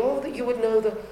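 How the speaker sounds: normal talking voice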